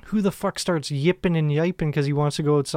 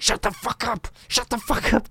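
high-pitched retold scolding; angry partner; 7 semitones higher than usual. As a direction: partner hushing a sleep-yeller, pitched up. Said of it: "J-Dog in my face being like".

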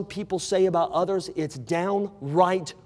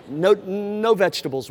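ordinary talk

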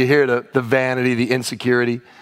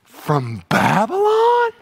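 flattered Hezekiah; 3 semitones lower, slower than usual. Retold or imitated. Pastor plays Hezekiah greeting envoys, fawning and flattered. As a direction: gush like a king who can't believe he's noticed. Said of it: "dripping with flattery, with insecurity".

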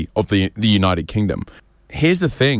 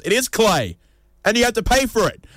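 smug artist; 12 semitones higher than usual. Retold or imitated. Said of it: "and the artist goes".

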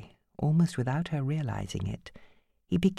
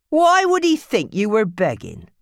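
raised, accusing question; angry accusation; 6 semitones higher, 6 dB louder than usual. Acted. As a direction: demand an answer. Phil's accusation.

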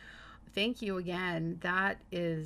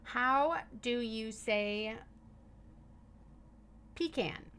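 slow, clear command to a phone; repeating to voice assistant; 4 semitones higher, slower than usual. Shown as a demonstration. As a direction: spell it out for the assistant. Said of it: "I'm gonna ask Google".

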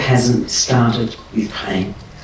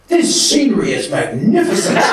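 lightly hammed-up grand pronouncement, higher and laughing; mock a nine-year-old sounding terribly grand; son being grand; 7 semitones higher than usual. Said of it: "he went".

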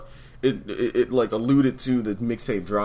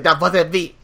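high loud goofy quote; mock caller voice; 6 semitones higher, 11 dB louder, slower than usual. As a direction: imitate the caller, pitched up. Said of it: "He was like".

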